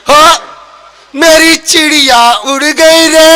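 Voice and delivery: put-on slow, drawn-out old granny wail; hammed-up grandmother's keening; old-style grandmother. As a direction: lament in a sing-song, holding the last note. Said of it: "वही पुराने type की दादी".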